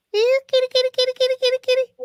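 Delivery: high sing-song kitty call; calling a cat. Real, not acted. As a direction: coax the cat over.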